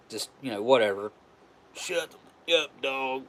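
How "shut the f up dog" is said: imitating the man snapping at his dog; retold yell at the dog. Man yelling at his barking dog, a mild mock yell. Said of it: "he yells at it".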